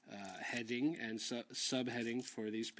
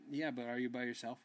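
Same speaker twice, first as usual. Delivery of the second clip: normal talking voice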